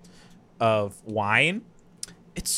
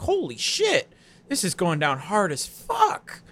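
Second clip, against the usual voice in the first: shocked; 7 semitones higher, slower than usual. Retold or imitated. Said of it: "It's like".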